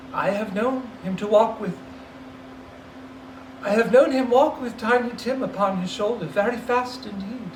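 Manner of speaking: a mother's voice catching on memory; Mrs Cratchit, grieving. Acted. Mother remembering Tiny Tim, voice breaking.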